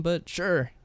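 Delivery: ordinary talk, nothing marked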